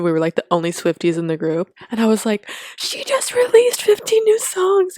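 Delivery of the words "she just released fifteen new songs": high, loud thrilled announcement; excited shout. Retold or imitated. Telling brother's friend at the party, high excited shout. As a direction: shout the news over the music.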